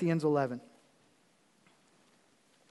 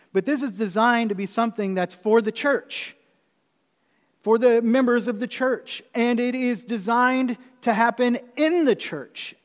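louder, higher, forceful pulpit delivery; emphatic preaching; 6 semitones higher, 7 dB louder than usual. Real, not acted. Pastor telling the congregation whom the ordinance is for, preaching forcefully. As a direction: drive each phrase home to the congregation, raised voice.

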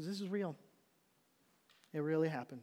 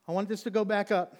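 raised, insistent protest; louder, pleading to escape; urgent demand; 4 semitones higher, 9 dB louder than usual. Acted. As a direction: loudly demand to be taken back up. Acted panic on descending elevator.

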